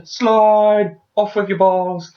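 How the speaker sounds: loud, high mock war shout; playful reenactment shout; hammed-up battle cry. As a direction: yell it like a cartoon villain. Acting out the villain's finishing move.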